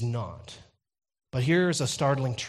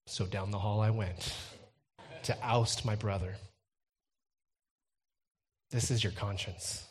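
ordinary talk

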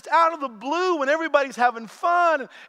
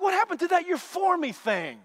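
bewildered protest; 4 semitones higher than usual. Retold or imitated. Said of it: "I'm like".

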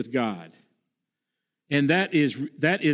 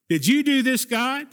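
higher, pleading cry of why; raised voice, crying out to God; anguished cry to God; 5 semitones higher than usual. Acted. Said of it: "He cries out to the Lord".

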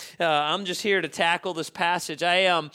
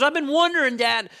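put-on child voice; 6 semitones higher, 5 dB louder than usual. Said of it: "He goes".

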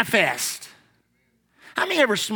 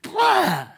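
high, drawn-out "blah" of disgust; mock retch; 6 semitones higher than usual. Preacher acting out puking after drinking, mock retch. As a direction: spew it out like bad whiskey.

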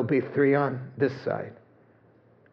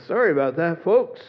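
higher, louder apologetic captain; breezy sorry from the cockpit; sheepish pilot; 4 semitones higher, 3 dB louder than usual. Retold or imitated. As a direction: pilot apologizing over the intercom. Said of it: "the pilot comes on and says".

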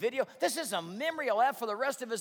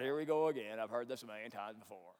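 low, sinking, weary grumble; mimicking a fed-up churchgoer tuning out; bored to death; 11 semitones lower, 4 dB quieter than usual. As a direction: let it sag lower and softer with boredom. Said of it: "we're bored to death and go".

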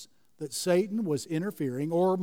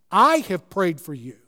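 "I" punched, a little louder; stress on I; 4 dB louder than usual. Shown as a demonstration. Preacher stressing that Jesus himself prayed, stress on "I". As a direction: lean hard on the word "I". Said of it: "the word "I" is first in the sentence, which in the Greek text makes it emphatic".

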